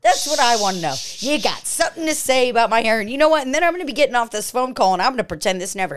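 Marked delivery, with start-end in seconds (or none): 0.0-4.5 angry. Acted. Angry employee on a call.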